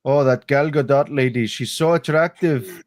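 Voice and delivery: high mock Saudi accent. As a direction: put on a thick Arabic accent as the prince. Mock Saudi prince voice continued.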